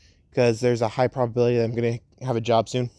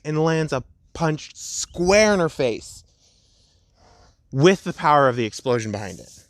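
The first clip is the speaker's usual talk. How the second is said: ordinary delivery, nothing marked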